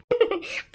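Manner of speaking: a short high mocking laugh. Sister-in-law laughs at her outfit, a short high mocking laugh. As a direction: laugh at her old-lady blouse.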